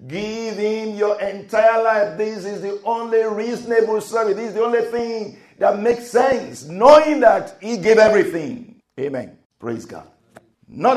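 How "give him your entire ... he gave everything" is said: preaching plea. Acted. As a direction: urge the congregation to give their whole life.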